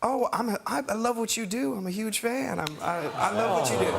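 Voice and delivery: high, halting fan-like gushing. Retold or imitated. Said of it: "It's, it's [name] again".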